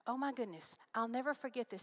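usual speaking voice

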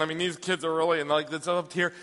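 usual speaking voice

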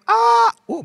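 high squealed delight; excited kid. Retold or imitated. Little girl hearing banana flavor, high excited squeal. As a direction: squeal like a kid offered candy.

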